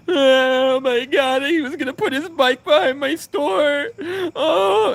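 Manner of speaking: slow, wailing diva meltdown, very high and loud; hissy fit. Retold or imitated. Mocking the store kid by throwing a fake hissy fit. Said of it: "I threw a big hissy fit".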